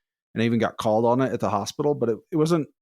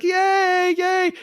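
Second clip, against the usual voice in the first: very high squealed cheer, louder; excited child; 5 dB louder than usual. Retold or imitated. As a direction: squeal it like a thrilled little girl. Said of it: "she's all excited".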